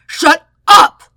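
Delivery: furious roared command. Acted. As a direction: explode at him. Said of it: "Zuko roars".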